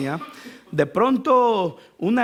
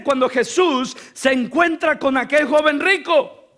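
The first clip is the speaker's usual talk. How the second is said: fiery preaching; 3 semitones higher than usual. Acted. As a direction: preach with full force. Preaching at full force.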